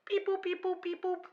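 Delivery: very high, chirpy mechanical beep-boops; robot beeps. Pretend. Mocking a TSA screening machine with high robotic beeps.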